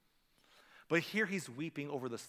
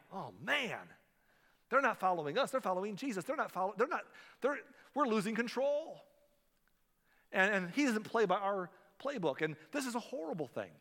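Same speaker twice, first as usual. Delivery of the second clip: upset Pharisees; 6 semitones higher than usual. Retold or imitated. Preacher voicing Pharisees losing control, with a touch of panic. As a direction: fret like leaders losing their grip. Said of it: "They were really upset".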